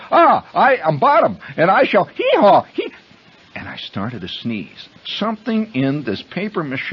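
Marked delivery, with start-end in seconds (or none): 0.1-2.9 high, loud hammed-up declaiming; donkey-headed stage player. Boy finally playing Bottom for the class, loud hammed-up declaiming. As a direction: proclaim grandly and break into braying. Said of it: "I start to do the scene".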